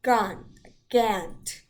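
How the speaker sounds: "gant" with a full, clear A instead of the lazy vowel; proper English vowel. Shown as a demonstration. Teacher showing a proper English accent, vowel pronounced in full. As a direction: say "gun, gant" loudly, each vowel fully sounded. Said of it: "with a proper English accent".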